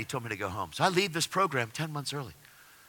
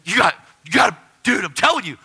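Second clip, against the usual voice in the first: loud, high insistence; zealous urging; 8 semitones higher, 10 dB louder than usual. Retold or imitated. New believer urging newcomers, zealous insistence. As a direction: press it on him forcefully.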